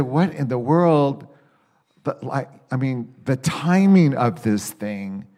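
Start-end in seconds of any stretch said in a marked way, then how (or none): 0.0-1.3 incredulous. Retold or imitated. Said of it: "I said".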